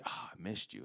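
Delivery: soft, fond sigh; tender. Shown as a demonstration. A partner greeting, soft and fond. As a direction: breathe it out fondly and softly. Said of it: "rarely do we say to each other".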